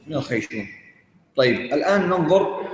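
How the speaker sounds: ordinary talk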